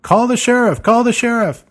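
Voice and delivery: high yell over the shoulder. Acted. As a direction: call back to the staff over your shoulder. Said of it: "yell over his shoulder".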